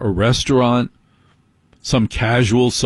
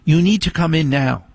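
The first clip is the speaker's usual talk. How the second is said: put-on higher, bossy teen daughter voice; imitating daughter; 6 semitones higher than usual. Dad mimics his teenage daughter summoning him inside. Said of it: "daughter will come out and go".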